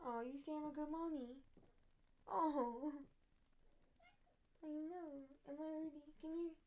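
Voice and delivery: sweet talk to a pet cat. Real, not acted. Cooing to her cat.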